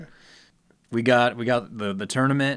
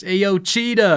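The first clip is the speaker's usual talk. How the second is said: high-pitched catchphrase call; Cheetah guy; 8 semitones higher, much slower than usual. Retold or imitated. Showing how the Instagram cook always starts, high call. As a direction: imitate a show opener, pitched up high. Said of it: "that always starts".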